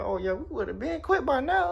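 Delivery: very high-pitched incredulous coworkers; mock coworker voice. Coworkers hear about missing pay, imitated high-pitched disbelief. Coworkers reacting to his unpaid weeks.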